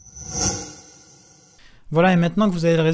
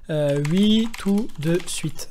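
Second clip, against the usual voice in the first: robot voice; much slower than usual. Retold or imitated. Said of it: "le PC vous réponde avec une voix de robot".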